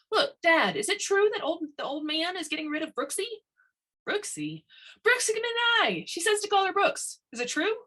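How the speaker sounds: put-on high, eager young woman's voice; bursting with news she hopes is false; breathless, high excited questions; excited girl.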